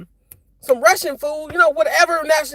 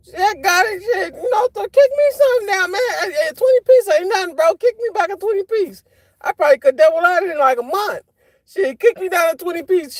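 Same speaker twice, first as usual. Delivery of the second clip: raised, pitched-up begging, half joking; mock-begging a rich celebrity, excited and higher; pleading with Shaq; 6 semitones higher than usual.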